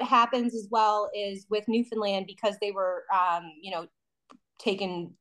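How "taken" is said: ordinary delivery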